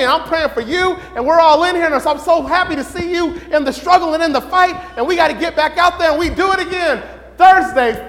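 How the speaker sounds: usual speaking voice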